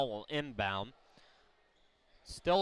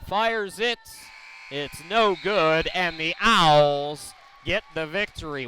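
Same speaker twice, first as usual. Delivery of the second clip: louder, rising call as the last shot misses; excited game call; 6 dB louder than usual. Real, not acted. Play-by-play announcer calling the winning final seconds, excited. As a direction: let the final buzzer call ring out. Buzzer-beater miss, game ends.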